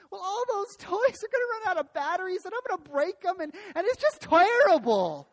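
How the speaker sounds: put-on high whiny pessimistic little boy; hammed-up tearful wail, dragged out at the end; mock crying. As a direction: wail like an upset child. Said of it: "he starts crying".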